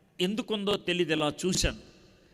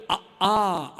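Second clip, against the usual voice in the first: put-on happy child shouting letters; 2 semitones higher, 5 dB louder than usual. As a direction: sing out the letters like a toddler. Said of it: "అని అరుస్తూ రాస్తుంటే".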